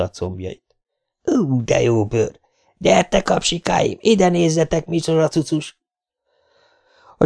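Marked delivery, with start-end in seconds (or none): 1.2-5.8 high, leering street punk calling his gang; leering young thug. Acted. Greasy-haired punk ogling the woman and calling his gang, leering. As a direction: drag out the ogling, then call the others.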